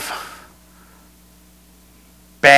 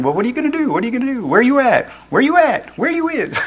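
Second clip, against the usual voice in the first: high, soft searching calls; people wondering where you are; 8 semitones higher, 5 dB quieter, faster than usual. Acted. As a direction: repeat it higher, lightly mock-searching. Voicing people searching for the hider.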